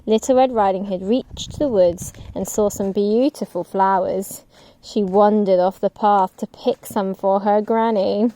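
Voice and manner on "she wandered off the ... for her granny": plain reading voice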